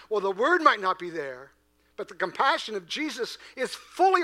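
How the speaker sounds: high, loud impassioned sermon; preaching at full force. Acted. Pastor telling the congregation why Jesus wept, impassioned preaching. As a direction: lift the room with conviction.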